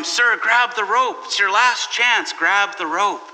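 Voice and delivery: mild mock call down from a helicopter; calling down urgently. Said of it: "calls down to him".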